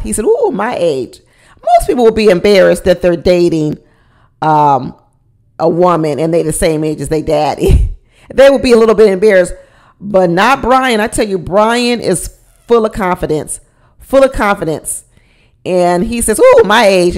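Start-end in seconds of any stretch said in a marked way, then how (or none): 0.3-1.2 hammed-up proud "Ooh, my age" with a laugh; mock boastful old man pleased with himself; said with pride. Boyfriend bragging about his age to Ingrid, hammed-up pride. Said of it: "And he said it with pride". 16.4-17.2 louder hammed-up "Ooh, my age"; said with pride. Brian proudly telling Ingrid she's his age, mock pride. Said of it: "And he said it with pride".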